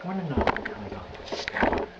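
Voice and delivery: deranged Joker, halting between phrases; Joker impression. Reciting the scars story, creepy Joker.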